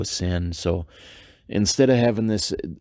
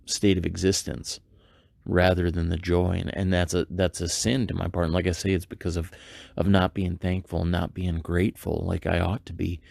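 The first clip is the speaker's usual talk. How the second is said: ordinary delivery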